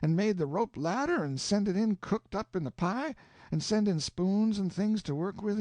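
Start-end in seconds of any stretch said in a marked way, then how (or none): none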